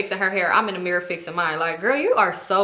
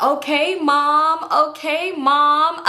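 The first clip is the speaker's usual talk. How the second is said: higher, drawn-out mock obedience; teasing; 5 semitones higher, 3 dB louder, much slower than usual. Girls laugh at their scolding friend, mock-obedient teasing. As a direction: tease someone bossy like a mother.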